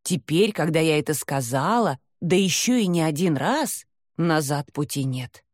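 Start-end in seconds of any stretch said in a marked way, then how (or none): none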